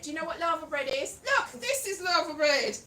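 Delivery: ordinary talk, nothing marked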